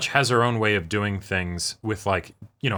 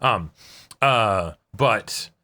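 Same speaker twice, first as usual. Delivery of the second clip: ordinary talk, nothing marked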